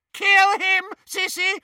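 very high, loud shriek. Acted. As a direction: shriek the order. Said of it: "shrieked Bellatrix".

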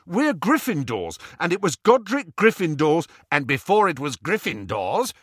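high, loud insistence; heated argument. Acted. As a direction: argue the claim hotly. Quarrel over who owns the sword.

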